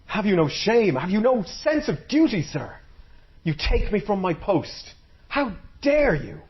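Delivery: furious high scolding voice; livid. Acted. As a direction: rage at the soldier who took her from her post. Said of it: "And she was livid".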